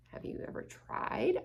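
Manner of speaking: lightly voiced timid puppy; small puppy question. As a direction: ask it gently as the little dog. Hugo asks softly.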